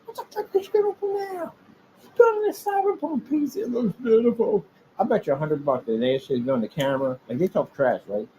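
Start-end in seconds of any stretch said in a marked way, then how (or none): 0.0-4.6 high mock wife who scolds and then admires the build. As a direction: play the wife yelling then melting. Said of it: "Wife will come like".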